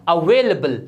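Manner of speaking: अवेलेबल with reduced middle, "a-VAY-luh-bul"; weak "luh" in अवेलेबल; correct pronunciation. Shown as a demonstration. Teacher models for learners, अवेलेबल said "a-vay-luh-bul". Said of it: "ये होता है".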